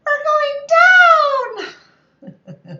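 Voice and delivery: put-on high, loud child's wail; imitating a small boy yelling we're going down; little boy's cry. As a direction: shriek it like a scared little kid. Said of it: "this wee little boy".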